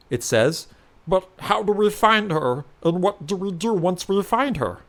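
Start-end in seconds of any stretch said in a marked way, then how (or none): 1.1-4.8 a lightly voiced changeling asking for the plan. Changeling asks the hero how to find her, higher.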